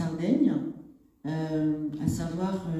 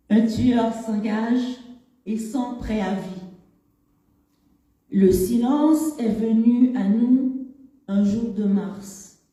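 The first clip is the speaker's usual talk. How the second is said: louder, higher, measured poem reading; poetry recitation; 4 semitones higher, 5 dB louder than usual. Acted. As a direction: recite it in separated phrases, with long pauses. Reads her poem aloud. (récitation de poème, voix posée et projetée)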